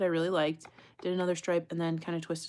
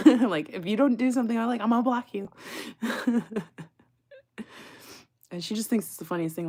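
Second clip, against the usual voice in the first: ordinary talk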